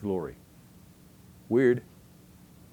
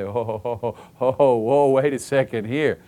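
loud drawn-out "oh ho ho" building to a slower "wait a second"; mock dawning realization; 2 semitones higher, 8 dB louder, slower than usual. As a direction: act out suddenly realizing the prophecy came true. Acted realization that prophecy came true.

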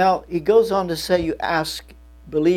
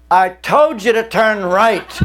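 slow, loud scolding; imagined scolding GPS; 6 semitones higher, 8 dB louder than usual. Pretend. A preacher voicing a GPS that scolds the driver, mock angry. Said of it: "you expect the voice to say".

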